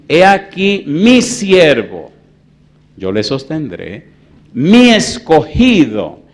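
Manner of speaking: very slow, loud, solemn proclamation; voice of God. Acted. Preacher voicing God's words in Isaiah, very slow and grand.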